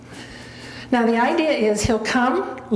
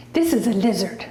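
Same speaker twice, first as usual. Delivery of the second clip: normal talking voice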